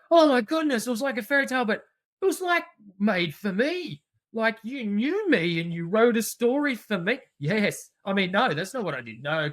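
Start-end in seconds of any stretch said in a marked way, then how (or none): 0.1-7.2 high, bright gasp of delight, louder at the start; wide-eyed kid who feels the story was made for them; thrilled child. Retold or imitated. Teacher voicing a child amazed by a fairy tale, high and excited.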